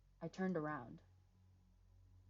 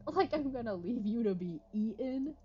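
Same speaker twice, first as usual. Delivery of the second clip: higher, scoffing retort; indignant female voice; 6 semitones higher, 7 dB louder than usual. Acted. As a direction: scoff at him in her voice.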